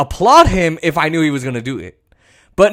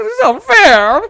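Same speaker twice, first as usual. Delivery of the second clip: much higher whiny mock voice; mock whining Republican; 14 semitones higher, 4 dB louder than usual. Mocking right-wingers crying, high whiny voice. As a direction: whine the complaint again. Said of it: "that's all right-wingers do, is they cry".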